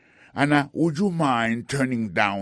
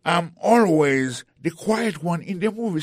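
protesting; 6 semitones higher, 4 dB louder than usual. Acted. Pete protesting to usher.

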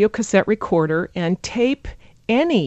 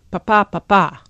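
"Pa, pa, pa, pa" alternating weak and strong beats; stress rhythm; 4 dB louder than usual. Shown as a demonstration. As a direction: beat out the sentence rhythm in "pa" syllables. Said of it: "the voice moves up and down".

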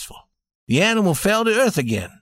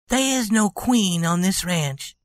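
tender; 3 semitones higher than usual. A narrator's reading. Wife tender declaration.

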